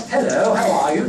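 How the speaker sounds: high, loud mock-polite greeting; put-on formal hello, sung out high; un-country hello. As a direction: exaggerate a proper, non-Aussie hello. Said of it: "Nothing like that".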